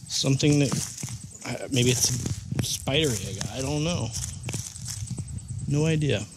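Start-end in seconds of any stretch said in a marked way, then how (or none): none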